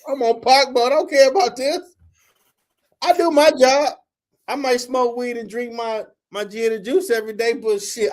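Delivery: imitating his younger, furious self; retold inner rant. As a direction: rant like you're outraged at the paddle. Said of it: "I'm thinking to myself".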